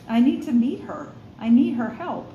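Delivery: ordinary delivery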